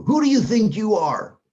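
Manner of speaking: loud, high, indignant demand; high and loud, offended and defiant; harsh challenge. Shown as a demonstration. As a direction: fling it back as an angry challenge. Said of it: "Maybe not with that t-, tone of voice".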